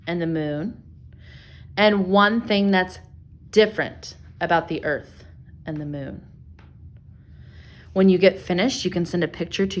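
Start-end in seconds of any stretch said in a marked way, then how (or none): none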